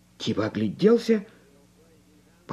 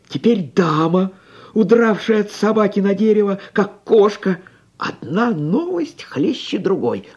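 rising, louder, quicker telling; excited; 7 semitones higher, 6 dB louder than usual. A narrator's reading. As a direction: tell it like hot news. Narrator excited by news.

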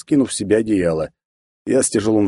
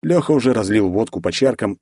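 usual speaking voice

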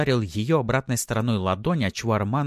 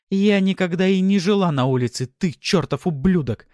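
high, loud woman's shout; heated fury; 12 semitones higher, 7 dB louder than usual. A narrator's reading. Wife insulting her husband, furious. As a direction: hurl the insult at him. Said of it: "распаляясь с каждым словом".

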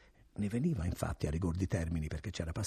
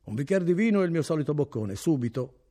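higher, louder merchant calling out; 8 semitones higher, 9 dB louder than usual. A narrator's reading. Merchant calling to the innkeeper for his bed, higher and louder. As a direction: order the host about with authority.

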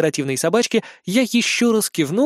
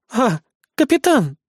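higher, slow-drawn feigned surprise; forced surprise; 7 semitones higher, 3 dB louder than usual. A narrator's reading. Idol girl caught by the captain, forced surprise. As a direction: squeeze out a surprised greeting. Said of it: "Пытаясь выдавить удивлённую улыбку".

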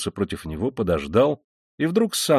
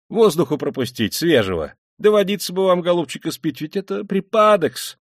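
high, urgent, fussing; agitated, high-pitched concern; flustered alarm; 8 semitones higher, 4 dB louder than usual. A narrator's reading. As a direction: fuss anxiously, pitch leaping up. Panicked man fetching air and water.

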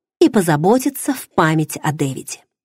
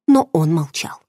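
plain reading voice

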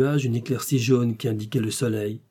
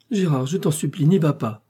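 higher-pitched, urgent entreaty; pleading; 5 semitones higher, 3 dB louder than usual. Acted. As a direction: beg him to stay, voice rising. Wife begs husband not to go.

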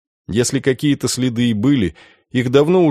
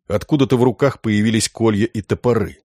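plain reading voice

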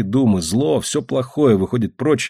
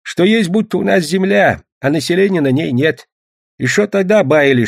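put-on high-pitched old peasant recounting hearsay; old storyteller, pitched well up; old grandfather; 7 semitones higher, 3 dB louder than usual. A narrator's reading. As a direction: tell the rumour in a high grandad voice. Grandfather tells old rumours.

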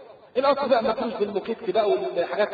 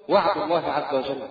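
usual speaking voice

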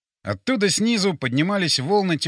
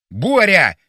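loud yell of a name; urgent raised-voice call; 3 semitones higher, 9 dB louder than usual. A narrator's reading. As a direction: yell for a missing companion. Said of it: "заорал я".

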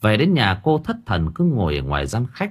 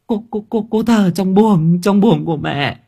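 much higher, stuttering frightened sobs; terrified stammer; 11 semitones higher, 5 dB louder than usual. Acted. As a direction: stammer in terror through the tears. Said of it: "Thảo òa khóc".

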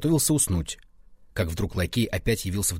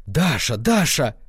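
calling a name out loudly, higher on the repeat; two raised, urgent calls; frenzied cry; 4 semitones higher, 5 dB louder, slower than usual. A narrator's reading. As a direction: cry out to her, frantic. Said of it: "закричал он".

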